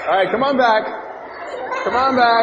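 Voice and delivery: loud high call across the room, laughing. Real, not acted. Teacher calling the running kids back, loud laughing call.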